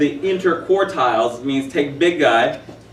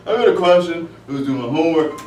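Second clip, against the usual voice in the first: high, loud put-on student voice; 5 semitones higher, 4 dB louder than usual. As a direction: ham up a student asking a question. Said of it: "why you sound like this".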